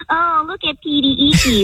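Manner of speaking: mocking sing-song baby voice; cutesy baby talk. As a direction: coo it like talking to a baby. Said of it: "talk to a baby and, like, be all cutesy with".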